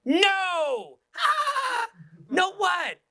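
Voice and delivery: very high squealed back-and-forth; playful exaggerated tone. Shown as a demonstration. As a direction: swoop way up, teasing. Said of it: "it's just in your tone".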